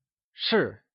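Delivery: 是 in the Mandarin fourth tone; falling tone. Shown as a demonstration. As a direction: let 是 fall straight down. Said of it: "is pronounced using a falling tone".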